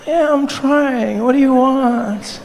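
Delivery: high, very slow sleepy whine; dog-tired woman. Acted. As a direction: act a tired woman pestered in bed. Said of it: "you're dog tired".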